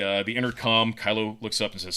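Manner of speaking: ordinary talk, nothing marked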